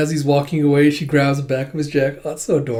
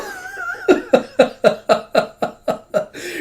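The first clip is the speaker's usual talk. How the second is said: real, high-pitched cackling; high laughing fit. Real, not acted. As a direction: burst out laughing at your own wail. Reader cracks up after wail.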